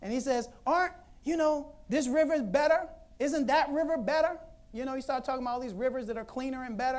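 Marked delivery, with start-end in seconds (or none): 0.7-4.4 indignant Naaman. Acted. Naaman arguing rivers.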